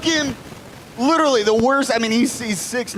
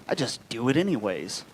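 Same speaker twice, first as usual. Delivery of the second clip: low, softer offhand reply; casual admission; 7 semitones lower, 7 dB quieter than usual. Retold or imitated. Buddy shrugging off his friend's complaint, casual and low. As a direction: toss it off low and matter-of-fact.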